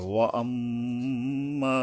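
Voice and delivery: ওয়া আম্মা very slow, long hummed m; gunnah then stretch. Shown as a demonstration. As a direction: chant ওয়া আম্মা level, holding mim and final a. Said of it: "গুণনা করে আবার এক আলিফ টানতে হবে". (ওয়া আম্মা গুন্নাহ করে ও টেনে পড়া)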